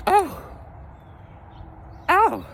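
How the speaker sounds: high mock falsetto oh; squeaky realization; imitating his date. Date realizing her mistake, in a high mock falsetto.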